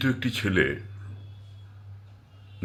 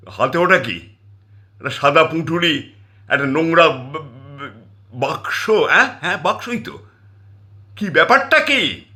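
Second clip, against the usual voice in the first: suspicious; 5 semitones higher, 3 dB louder than usual. Acted. Wife interrogates husband.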